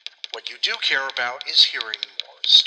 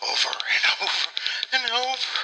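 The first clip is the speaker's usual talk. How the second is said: comic breakdown; 12 semitones higher than usual. Pretend. Treadmill repetition breaking into laughter.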